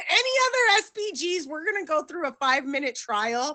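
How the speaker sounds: high giggling announcement; laughing. Real, not acted. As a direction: laugh through the offer.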